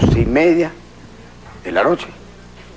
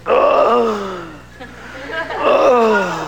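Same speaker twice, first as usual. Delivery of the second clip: mock pained groan; 8 semitones higher than usual. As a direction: groan like aching teeth. Pasajero con dolor de muelas. (quejido exagerado de dolor)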